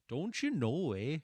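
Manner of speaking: a light, jokey Midwest accent; mildly drawn-out Midwest accent, teasing. Shown as a demonstration. Streamer teasing chat about Midwest accents, light Midwest accent. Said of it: "If you guys know what a Midwest accent sounds like".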